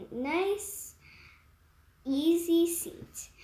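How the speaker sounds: usual speaking voice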